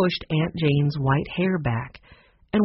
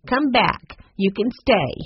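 higher-pitched call to return; crying out; 5 semitones higher than usual. A narrator's reading. Aunt Jane lets the dog stay, calling out. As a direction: call out as you relent. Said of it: "cried Aunt Jane".

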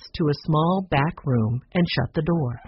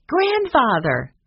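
higher, drawn-out happy exclamation; excited cry; 8 semitones higher, 3 dB louder, slower than usual. A narrator's reading. Children recognising their grandfather's name, excited cry. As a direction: stretch the word out in delighted surprise. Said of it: "the children cried".

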